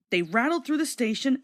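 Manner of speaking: usual speaking voice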